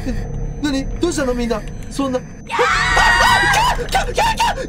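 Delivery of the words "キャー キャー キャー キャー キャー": loud, piercing panicked scream; long high screech; high-pitched scream. Real, not acted. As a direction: shriek high at a sudden scare. Scare in a horror game.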